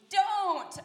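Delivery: sharp high yell of don't. Retold or imitated. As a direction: snap it out like an upset child. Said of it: "I specifically remember her yelling to me".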